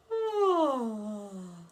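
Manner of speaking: a big, wide-open yawning sigh; demo yawn sigh. Shown as a demonstration. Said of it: "So it's like".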